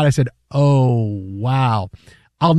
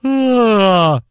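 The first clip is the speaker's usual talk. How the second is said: mock yawn; 5 semitones higher than usual. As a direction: yawn it out big. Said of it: "And I yawn".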